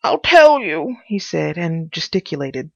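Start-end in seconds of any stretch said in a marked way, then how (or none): 0.0-1.0 high, loud character voice; agitated Pycraft. Acted. Pycraft protesting to his visitor, high and insistent. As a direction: burst out insistently, pitched up.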